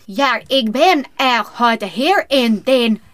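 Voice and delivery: slow, exaggerated American-accented German; hammed-up American accent reading a German audition line; bad American accent. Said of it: "das hört sich katastrophal an".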